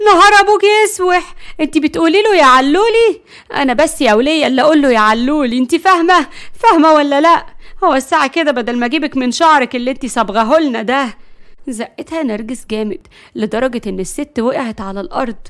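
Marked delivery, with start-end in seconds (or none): 0.1-11.1 loud, high, outraged tirade; shouted catfight threats; jealous fury. Acted. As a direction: tear into the rival for her cheek. Jealous wife quarrels with rival.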